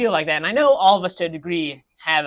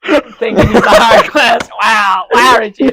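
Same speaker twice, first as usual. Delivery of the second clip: higher, louder, slow mock indignation; mock offended; 8 semitones higher, 15 dB louder, slower than usual. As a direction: play hurt and outraged.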